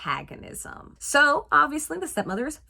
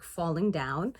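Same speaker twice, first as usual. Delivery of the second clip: normal talking voice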